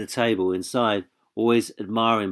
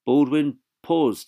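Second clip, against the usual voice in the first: plain reading voice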